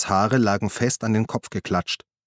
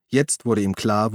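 usual speaking voice

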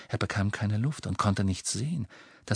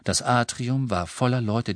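ordinary delivery

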